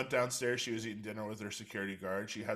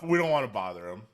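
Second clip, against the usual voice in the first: mock excuse; 9 dB louder than usual. Mimics friends' excuse, much louder.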